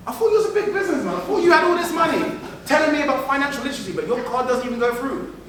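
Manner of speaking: high, incredulous teasing; mock disbelief. Mocking a boaster whose card declines.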